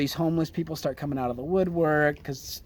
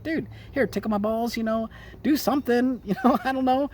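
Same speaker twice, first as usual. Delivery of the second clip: lightly voiced, higher-pitched casual coaxing; playful mock request; 6 semitones higher than usual. Host imagining Ed Buck asking for fun, playful higher voice. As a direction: toss it off high with a chuckle.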